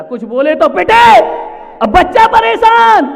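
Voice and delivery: high, loud exclamation; thundering plight. Real, not acted. Preacher on child beaten for speaking, shouted.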